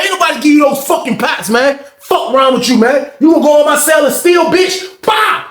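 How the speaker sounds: high-pitched furious yell at a thief; angry yelling. Retold or imitated. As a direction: yell it like you caught him stealing. Inmate yells at thief Cash.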